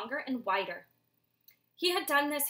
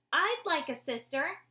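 high, louder little owlet peep; owlet's higher, louder peep; 6 semitones higher, 5 dB louder than usual. Acted. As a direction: pipe up small and eager. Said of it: "Soren peeped up".

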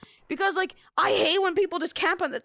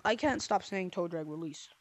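ordinary talk, nothing marked

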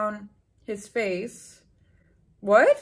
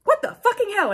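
high, loud hammed-up fury; mock angry; 11 semitones higher, 6 dB louder than usual. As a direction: blurt it out high and outraged. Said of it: "I asked angrily".

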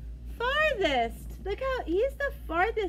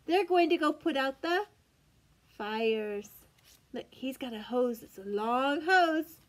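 ordinary delivery

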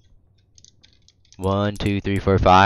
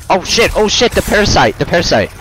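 panicked; 8 semitones higher than usual. Real, not acted. Panicked at parasite.

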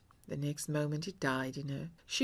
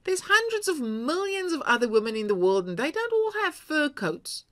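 rising exasperation, pitch climbing; reasoning harder and harder, voice rising; exasperated husband; 5 semitones higher, 5 dB louder than usual. A narrator's reading.

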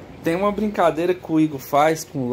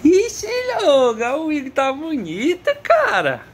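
excited laughing; 13 semitones higher, 4 dB louder than usual. Real, not acted. Owner laughing and teasing his excited dog. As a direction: tease the dog through laughter.

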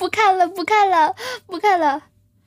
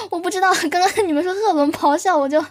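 normal talking voice

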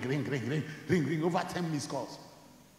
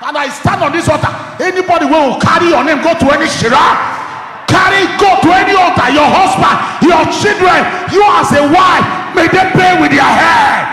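much louder, higher preaching curse; fiery preaching; 9 semitones higher, 18 dB louder than usual. Acted. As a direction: thunder it down from the pulpit. Preaching at full force.

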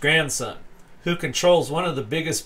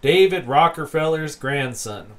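normal talking voice

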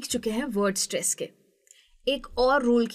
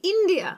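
"India" with IN high and punched; front-stressed "India"; stress on first syllable; 7 semitones higher than usual. Shown as a demonstration. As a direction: hit IN in "India", drop the rest. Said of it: "इस तरीके से stress होता है".

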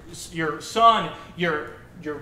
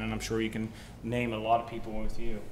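ordinary delivery

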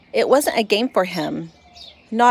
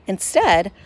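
ordinary delivery

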